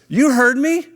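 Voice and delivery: high, loud mock defiance; defiant challenge. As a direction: imitate a friend standing up to a bully. Retold friend standing up to bully.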